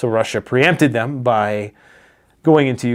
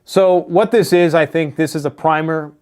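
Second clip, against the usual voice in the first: ordinary talk, nothing marked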